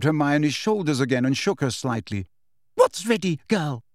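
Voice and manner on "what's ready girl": higher, more forceful demand; insistent demand. A narrator's reading. Umbridge shaking Hermione by the shoulders, insistent demand. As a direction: shake the answer out of her. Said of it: "demanded Umbridge, and now she grabbed".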